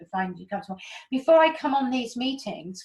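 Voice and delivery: ordinary delivery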